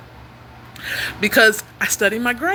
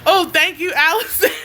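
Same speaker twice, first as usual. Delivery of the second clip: higher-pitched thanks tipping into laughter; laughing thanks; 5 semitones higher than usual. Real, not acted. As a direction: thank her, then burst out laughing. Thanks a viewer, breaking into laughter.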